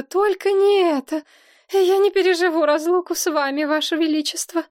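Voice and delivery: high, melodramatic wail; sobbing, acted. A narrator's reading. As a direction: plead tearfully in a high voice. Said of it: "зарыдала Розамунда". (рыдая, высоким голосом)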